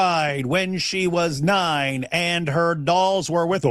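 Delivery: slow, deliberate, word by word; slow emphatic reading. Real, not acted. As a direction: lay each word down slowly to make the point. Repeats the hadith line again.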